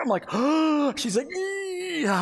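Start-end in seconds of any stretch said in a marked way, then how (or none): 0.3-0.9 high, sharp mock gasp; mock panicked gasp. Husband watching his wife have a contraction, mock-alarmed gasp. 1.3-2.2 high, squeezed, drawn-out mock strain; strained through pain. Wife insisting to husband she is fine, comically strained.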